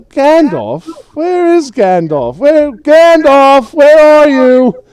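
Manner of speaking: very high, loud drawn-out shout; drunken calling out. Acted. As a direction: holler for a missing friend, drunkenly. Drunk hobbit calls out for Gandalf.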